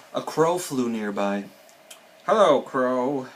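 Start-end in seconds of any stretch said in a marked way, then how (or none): 2.3-3.3 loud, drawn-out hail; storybook shout. A narrator's reading. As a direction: call out slowly to someone passing. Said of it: "shouted Toad".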